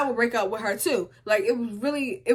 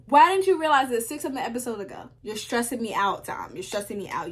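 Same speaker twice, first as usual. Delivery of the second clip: normal talking voice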